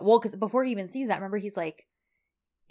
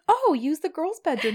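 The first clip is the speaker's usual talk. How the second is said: bright, much higher, eager mom voice; eager mother; 8 semitones higher, 5 dB louder than usual. Retold or imitated. Mother eagerly offers the bedrooms, pitched high. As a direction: play the overjoyed hostess, pitched up. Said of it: "And she's like".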